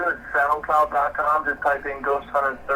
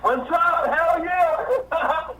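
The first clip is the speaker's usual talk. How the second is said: much higher, laughing with joy; excited; 12 semitones higher, much slower than usual. Real, not acted. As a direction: burst out in real excitement. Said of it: "I get so excited".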